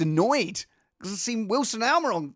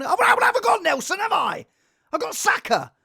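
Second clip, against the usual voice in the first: loud, fast and higher, mock outrage at his mate; indignant outburst; 8 semitones higher, 5 dB louder than usual. Answering his mate at the match, loud indignant outburst. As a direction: bark it back in disbelief.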